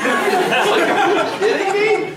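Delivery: high, loud, drawn-out disbelief; exasperated. Retold or imitated. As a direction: exclaim it in mock outrage. Exasperated reaction to the girl's complaint.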